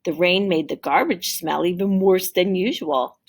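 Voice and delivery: ordinary delivery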